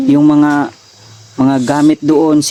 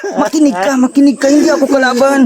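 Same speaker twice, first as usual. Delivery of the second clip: high-pitched, insistent pleading; urgent pleading; 7 semitones higher than usual. Acted. Man begs a struggling captive to stop hitting and listen, urgent pleading.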